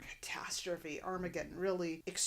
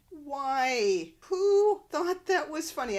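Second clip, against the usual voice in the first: high, loud exasperated outcry; raised voice of indignant protest; loud exasperation; 8 semitones higher, 12 dB louder, much slower than usual. Real, not acted. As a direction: demand it in disbelief, drawing words out. Host objects to a tasteless joke.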